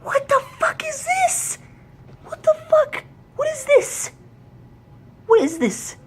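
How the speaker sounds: extremely high falsetto shriek; sky-high squeal of disbelief. Real, not acted. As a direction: squeal it out, beside yourself. Rant peaks at the interview.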